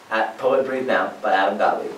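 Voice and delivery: normal talking voice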